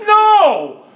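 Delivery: loud playful protest; mock-indignant no. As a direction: burst out in fake outrage. Mock-shocked answer to listener.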